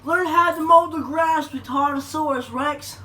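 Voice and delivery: mock yell. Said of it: "I went to yell".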